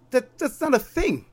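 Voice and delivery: high, incredulous insisting; exasperated. Retold or imitated. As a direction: let the disbelief pitch it way up.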